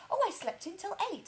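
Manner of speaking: high-pitched mock mum voice; imitating mum. Imitating mum boasting about her chill morning, mock high voice. Voicing mum's idea of chilling.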